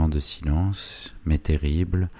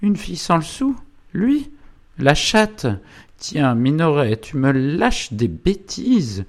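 very high incredulous wife, then scornful; Zélie; 11 semitones higher, 4 dB louder than usual. Acted. Wife hears son loved penniless girl, scornful disbelief. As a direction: scoff in disbelief, pitch leaping up.

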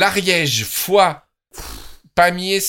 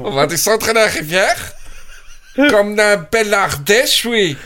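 higher, louder mock Ardèche accent; 6 semitones higher, 3 dB louder than usual. Joking about a trip down to the river, mock Ardèche accent. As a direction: put on a hearty Ardèche accent. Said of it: "C'est typique ardéchois".

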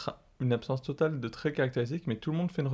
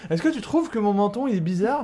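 worried character voice; 7 semitones higher, 3 dB louder than usual. Retold or imitated. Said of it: "lui il est".

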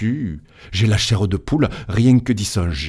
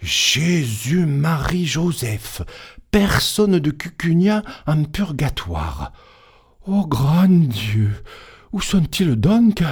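the curé aghast, drawing out each word higher; dismayed priest; 6 semitones higher than usual. Acted. Curé horrified, no flock in purgatory.

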